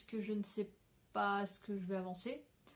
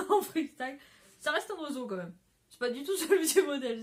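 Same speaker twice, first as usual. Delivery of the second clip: talking through real laughter, higher and louder; giggling, voice jumping up and louder; laughing; 5 semitones higher, 9 dB louder than usual. Real, not acted. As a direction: laugh at your own botched handiwork. Amused at her botched bird.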